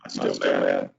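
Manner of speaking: deep, distorted glitchy audio voice; warped low voice over a broken mic; monster voice. Real, not acted. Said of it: "Jim's is still".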